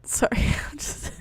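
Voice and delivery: trying to read through giggles; words broken up by real laughter; laughing. Real, not acted. Reader cracking up over the story, laughing. Reader breaks up while reading.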